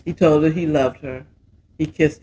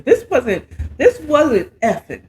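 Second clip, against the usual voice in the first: laughing through the words, higher; tickled, laughing it out; giggling; 7 semitones higher than usual. Real, not acted. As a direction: giggle while you explain. Giggling over racy scene.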